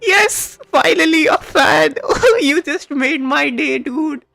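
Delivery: high, loud, slow giddy thanks with laughter; overjoyed. Real, not acted. Streamer thanking a fan for making his day, giddy and high. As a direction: gush with real delight.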